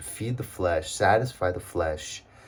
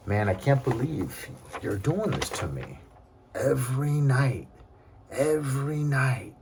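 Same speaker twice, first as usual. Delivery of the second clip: exasperated complaint; 5 semitones higher than usual. Retold or imitated. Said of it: "I was like".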